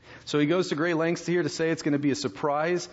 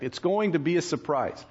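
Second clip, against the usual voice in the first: ordinary delivery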